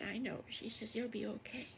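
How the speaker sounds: soft reassuring murmur; gently calm him; comforting. Acted. Missus Farmer Brown comforting crying wolf, softly. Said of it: "And she says".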